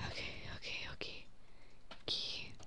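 soft whispered okays; whispering. Real, not acted. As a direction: whisper it under your breath. Quiet murmur while playing.

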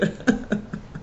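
soft, unvoiced laugh; a quick breathy chuckle. Real, not acted. Teacher laughing at her own dog joke, soft breathy laugh.

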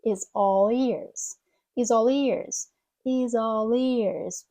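very slow "is all ears" run as "izallears"; slow linked words. Shown as a demonstration. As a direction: slide "is all ears" together slowly. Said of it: "Поєднуємо ці слова".

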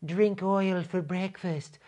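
slow, put-on scolding granny voice; mock the old lady, drawn out; hammed-up granny lecturing Greta; mock Granny Petrol. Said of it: "that stupid Granny Petrol".

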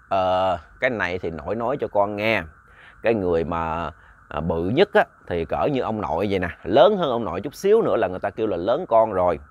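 a hint of a patient grandpa voice; lightly voiced grandfather. A narrator's reading.

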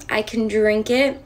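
ordinary talk

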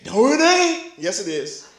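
quick contradiction from the pews; objecting congregant. Acted. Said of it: "I know what you're thinking".

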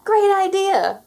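high, loud, drawn-out shout of joy; excited cry. Acted. Terry answering Nobody with a joyful cry. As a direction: cry out in delight. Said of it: "cried Terry".

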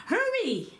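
urgent high-pitched command; king urgently ordering his guards to hurry. A narrator's reading. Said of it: "the king commanded his guards".

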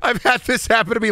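can barely get it out for laughing; giggling retelling, voice up high. Real, not acted. Laughing at own story.

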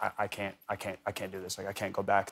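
hushed despair. Retold or imitated. Wife on the third night pleading quietly. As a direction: say it softly, as if worn down. Megan quoted during a hard night.

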